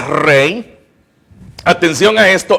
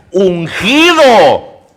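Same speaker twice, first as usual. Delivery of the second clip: UNGIDO drawn out slowly and louder; emphatic stress; 4 dB louder, much slower than usual. Real, not acted. Preacher recalling Abigail's promise to David, emphatic stress on ungido. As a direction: stretch ungido, pushing it hard.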